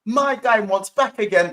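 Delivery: put-on loud, slow London accent; London accent impression. Showing the YouTuber's other accent, loud drawn-out London. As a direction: draw it out in broad London. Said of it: "That's [name]'s London accent".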